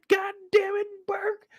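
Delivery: high, scolding boss voice; angry captain. Retold or imitated. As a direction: warn Burke everyone is watching. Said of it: "He said".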